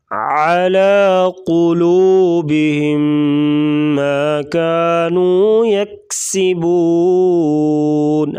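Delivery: slow chant, throaty ain; throat ain. Shown as a demonstration. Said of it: "आइन की आवाज़ गले से निकालेंगे".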